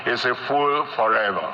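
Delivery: ordinary talk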